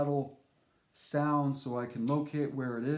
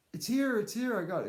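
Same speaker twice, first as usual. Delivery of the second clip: high, quick mock panic; imitating her frantic excitement; excited; 7 semitones higher than usual. Woman texting about the snake, excited.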